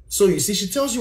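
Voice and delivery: ordinary talk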